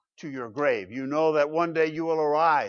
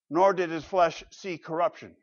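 ordinary talk

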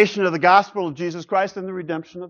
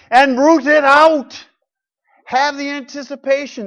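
much higher and louder, slowed right down; emphatic preaching cry; forceful exhortation; 11 semitones higher, 9 dB louder than usual. Real, not acted. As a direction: drive it home to the congregation.

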